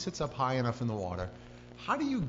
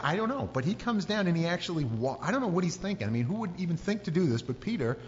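usual speaking voice

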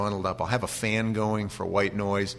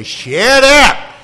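much higher, much louder yell; shut up yelled; 18 dB louder than usual. Shown as a demonstration. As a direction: bark it out the back window at a yapping dog. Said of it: "No, you say".

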